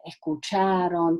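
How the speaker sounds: ordinary talk, nothing marked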